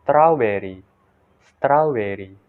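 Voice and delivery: "strawberry" said "strau-berry"; Indonesian-style "strawberry"; Indonesian pronunciation. Shown as a demonstration. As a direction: demonstrate "strawberry" with an "ow" first vowel. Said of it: "Indonesian people pronounce strawberry like".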